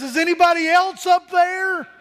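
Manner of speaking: high, loud call upward; punchline call. Retold or imitated. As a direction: call up again, hoping for someone else.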